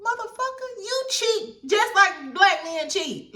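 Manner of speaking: mock outraged high-pitched clapback; calling him out. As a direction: scold him like you can't believe his nerve. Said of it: "which I be like".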